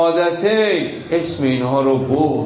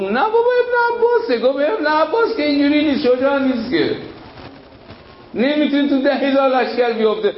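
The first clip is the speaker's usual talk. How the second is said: much higher, fast, scoffing voice; dismissive soldier; 9 semitones higher, faster than usual. Acted. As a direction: rattle it off mockingly high. Soldier voiced answering Muawiya.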